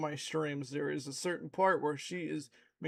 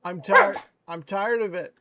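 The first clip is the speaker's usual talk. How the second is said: much louder, fed-up shout at the dog; exasperated loud scolding; 8 dB louder than usual. Real, not acted. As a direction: let the frustration burst out at full volume.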